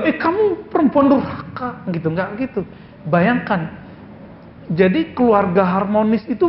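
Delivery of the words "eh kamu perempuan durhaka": higher, hammed-up scolding husband; mock rebuke. Imagined husband scolding his wife, mock angry. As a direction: pretend to snap at a disobedient wife. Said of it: "Nggak bilang".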